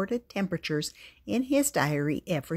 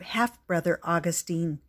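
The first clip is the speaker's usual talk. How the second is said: ordinary delivery, nothing marked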